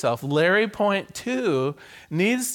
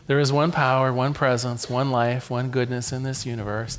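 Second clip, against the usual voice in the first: prayer; 4 semitones lower than usual. Acted. Minister leading the congregation in a closing prayer, reverent. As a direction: lead the room into prayer.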